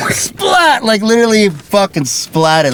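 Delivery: hyped-up shout of SPLAT; excited shout. Real, not acted. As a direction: yell it out, totally amped.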